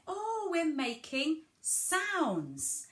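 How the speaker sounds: high, drawn-out "sounds"; "sounds" sung out long and high; emphasis on sounds. Shown as a demonstration. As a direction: stretch out "sounds" slowly and high for a child. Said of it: "you emphasize the word sounds".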